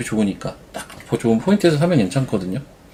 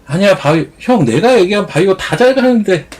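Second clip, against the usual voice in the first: much higher, louder pushy friend; 9 semitones higher, 4 dB louder than usual. Retold or imitated. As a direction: insist hard like a stubborn friend. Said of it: "맨날 이러고".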